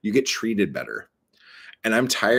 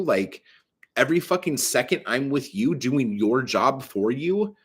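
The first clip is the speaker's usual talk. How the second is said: ordinary delivery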